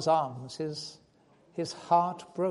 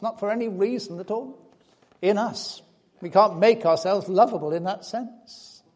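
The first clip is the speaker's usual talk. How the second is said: louder, impassioned sermon voice; fervent preaching; 3 semitones higher, 7 dB louder than usual. Acted. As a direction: preach it out to the congregation. Preaching builds on God's love.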